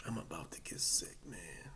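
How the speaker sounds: faint whisper, about to faint; queasy whisper. Retold or imitated. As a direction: whisper it like you're going to be sick. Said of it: "I had that feeling like".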